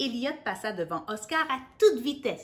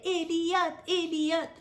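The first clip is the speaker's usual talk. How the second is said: a lightly voiced crowd chanting his name; crowd cheer; 7 semitones higher, slower than usual. Reading to a child, lightly voiced crowd cheering the hare. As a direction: chant it like fans.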